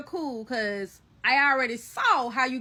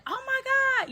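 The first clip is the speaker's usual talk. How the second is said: very high mock-panicked exclamation; retold panic; 14 semitones higher, much faster than usual. Watching the cat attack Kay, retold panic. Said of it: "I immediately panicked".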